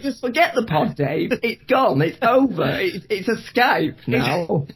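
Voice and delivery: high, pleading bloke down the pub; exasperated pub drinker, pitched high. Acted. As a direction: beg Dave to drop the pod, voice up high. Pub bloke telling Dave.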